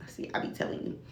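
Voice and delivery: whispered aside. Real, not acted. Aside after reciting lyrics.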